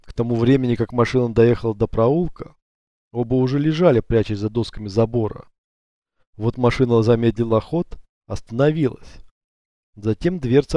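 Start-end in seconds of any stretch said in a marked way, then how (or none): none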